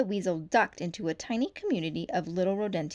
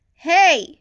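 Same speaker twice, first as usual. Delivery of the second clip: loud, high call; yell; 9 semitones higher, 16 dB louder than usual. A narrator's reading. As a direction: shout to halt the runner. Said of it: "she yelled".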